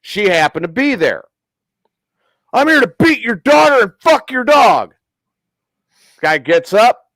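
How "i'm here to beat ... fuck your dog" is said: high, loud mock tough retort; cousin's cocky comeback, raised and loud; deadpan comeback. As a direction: fire it back without missing a beat. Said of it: "That's why he said it that way".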